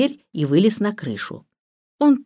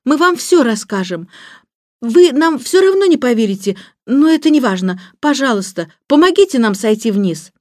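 put-on high, louder girlish begging; pleading; 7 semitones higher, 6 dB louder than usual. A narrator's reading. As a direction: plead urgently to be believed and helped. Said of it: "молила его Джейн". (жалобная мольба)

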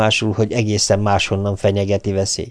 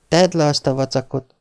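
wife's voice; 5 semitones higher than usual. Acted. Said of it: "mondta az asszony".

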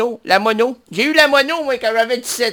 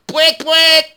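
silly horn honk; 5 semitones higher than usual. Pretend. Honking like a bus horn.

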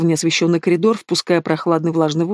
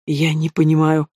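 strained choked words. Acted. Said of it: "Слова пленника выходили сдавленными".

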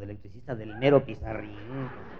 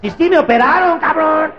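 sudden high, loud shout; 16 dB louder than usual. Retold or imitated. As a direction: blurt it out at full volume. Reacts to being asked, shouting. (grito agudo y fuerte)

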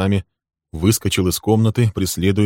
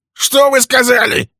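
much higher, loud outraged question; outraged disbelief; 7 dB louder than usual. Acted. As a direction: Walter hearing he is accused, outraged. Walter outraged.

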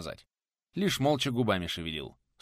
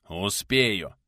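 short, louder snap back; snapped retort; 5 dB louder than usual. A narrator's reading. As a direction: brush him off crossly. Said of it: "огрызнулся в ответ тот".

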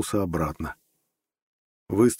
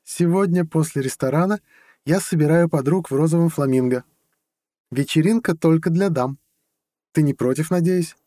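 much higher woman's voice; assertive girlfriend; 9 semitones higher, 4 dB louder than usual. A narrator's reading. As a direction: announce plans brightly, leaving no room for objection. Girlfriend announces her party plans. (высокий уверенный голос девушки)

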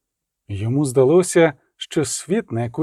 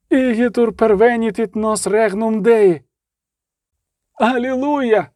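high, ringing Latin exclamation; exalted praise; 5 semitones higher than usual. A narrator's reading. Monk prostrate on the floor praising God, exalted. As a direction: cry it out in rapture.

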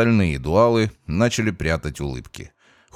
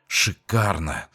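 slow, savoring, hungry grin; predatory relish; slower than usual. Acted. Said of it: "плотоядно улыбнулся главарь". (плотоядно, с ухмылкой)

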